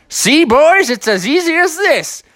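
high, put-on character voice; showing-off bear. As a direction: boast with a fish in your mouth. Bear shows off fish in mouth.

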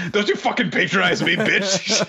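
mock furious squeal. Joining the joke of Asumi's imagined rage, high laughing mock fury. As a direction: shriek the insult while half laughing.

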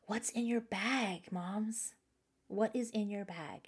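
soft, slow, unvoiced whisper; breathy hush, almost secret. Shown as a demonstration. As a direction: lean in and whisper the question to the moms. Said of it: "I want you to hear that again".

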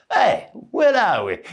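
high, bewildered mock Italian accent. Friend's Italian dad landing in Australia, mock Italian accent. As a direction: imitate a puzzled Italian immigrant, pitched high. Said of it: "his old man couldn't speak really good English".

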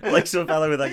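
ordinary talk

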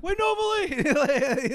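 high, slow drawn-out call; imitated screaming. He calls to the woman running away, mock scream. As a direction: call out to stop her leaving. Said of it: "he starts screaming".

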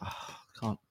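a whispered, muttered "Oh, I can't"; drunk mumble. Retold or imitated. The groom muttering as he searches his pockets.

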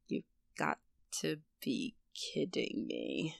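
breathy, hushed disbelief; half-whispered, stunned. Retold or imitated. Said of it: "I was like".

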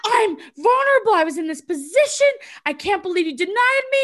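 high, loud mock outrage; re-enacted wounded indignation; indignant protest. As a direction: protest loudly through amusement. Re-enacts her indignant quarrel, amused.

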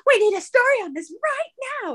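high-pitched mock urgency; urgent newsroom demand. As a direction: imitate an editor demanding copy at once.

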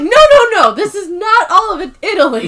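high, loud mock-indignant objection; frantic protest. Acting out Michael's frantic protest.

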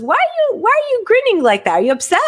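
high-pitched questioning aunt; high mock-concerned questioning; imitating aunt. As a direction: mimic a puzzled relative way up high. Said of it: "it was my aunt, she goes".

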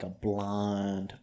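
ordinary delivery

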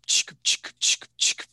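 whispered shutter clicks, no voice; imitated camera clicks. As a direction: click your tongue like a shutter. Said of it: "chick, like this".

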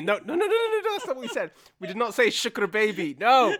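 high, loud frantic mock correction; exasperated parent. Parents correcting mispronounced Arabic, hammed-up frantic refusal. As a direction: shoot it down in hammed-up alarm. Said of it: "your parents are like, "No," they shake their head violently".

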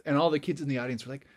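ordinary delivery, nothing marked

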